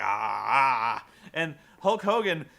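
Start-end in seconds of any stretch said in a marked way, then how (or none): 0.0-1.1 low, louder wordless grunts; Ultimate Warrior grunts. Retold or imitated. A wrestler's ghost grunting from behind a mirror. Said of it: "doing his Destrucity thing".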